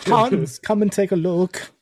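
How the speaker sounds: high, slow German soldier voice; German accent. Pretend. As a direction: call Hans over in a mock German accent. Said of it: "my German accent has a lisp".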